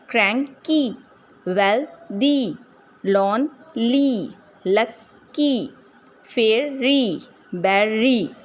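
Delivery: slow two-beat words, क्रैं-की, वेल-दी, लॉन-ली, लक-की, फे-री, बे-री; split syllables. Shown as a demonstration. Teacher separating syllables so learners can confirm two, split syllables. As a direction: pause before the long final ई in each word from क्रैंकी to बेरी. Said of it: "syllables को अलग-अलग करने के लिए इस तरह से read किए हैं".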